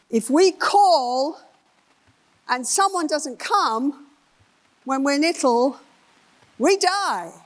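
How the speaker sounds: slow and rising to a high we die; emphatic building. Real, not acted. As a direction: land we die high and hard. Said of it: "emphatic climax of attachment quote".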